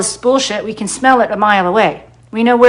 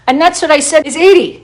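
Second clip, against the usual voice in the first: usual speaking voice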